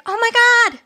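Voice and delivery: high, loud gushing yes; enthusiastic. Retold or imitated. Audience woman answering the psychic, high loud excitement. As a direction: fan thrilled to be picked out. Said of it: "This woman is so enthusiastic".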